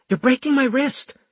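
pained hiss. A narrator's reading. Medic to the patient gripping her wrist, hissed protest. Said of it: "and she hissed".

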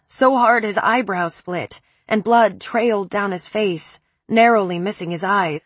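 plain reading voice

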